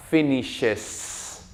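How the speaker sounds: "finishes" with a long, distinct final es; clear ES ending. Shown as a demonstration. Teacher modeling third person for students, "finishes" with clear es ending. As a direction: draw out the last s of "finishes". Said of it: "esta E S que suene fuerte y claro".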